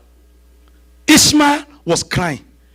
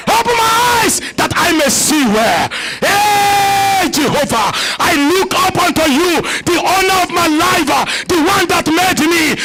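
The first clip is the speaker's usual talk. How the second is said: fervent prayer; 4 semitones higher than usual. Acted. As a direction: pray it out with full force. Leads prayer at full force.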